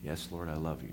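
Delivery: almost under the breath; meek reply. Acted. Peter answers Jesus quietly and humbly. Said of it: "Peter answers again".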